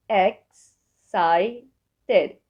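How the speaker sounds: "X، ساي، تد" as three separate beats; syllable by syllable. Shown as a demonstration. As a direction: break it into "X", "ساي", "تد" with pauses. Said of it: "أقسمها لمقاطع". (نطق "X، ساي، تد" مقطعًا مقطعًا)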